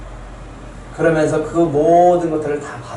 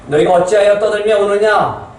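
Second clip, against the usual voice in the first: higher, louder rebuking question; stern rebuke; 5 semitones higher, 3 dB louder than usual. Acted. Jesus questioning the weeping mourners, stern rebuke. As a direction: challenge the wailing crowd.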